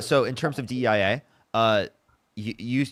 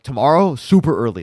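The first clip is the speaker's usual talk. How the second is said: raised voice announcing; loud; 4 semitones higher, 9 dB louder than usual. Real, not acted. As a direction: call it out loudly.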